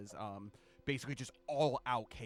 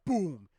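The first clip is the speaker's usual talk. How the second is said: loud, punched boom sound effect; big vocal explosion; imitated explosion; 6 semitones higher, 13 dB louder than usual. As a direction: burst it out like a sudden blast. Game master imitates explosion.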